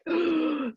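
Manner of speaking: a sharp delighted intake of breath; excited gasp. Retold or imitated. Sharp gasp when Cheryl opens up a story. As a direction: gasp like a treat just landed.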